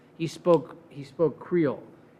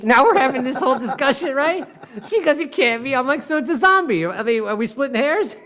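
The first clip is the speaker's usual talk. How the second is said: high, louder, fired-up telling; excited retelling; 7 semitones higher, 3 dB louder than usual. Real, not acted. Lawyer gleefully recounting his courtroom grilling, high and loud. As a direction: build the excitement of the cross-exam.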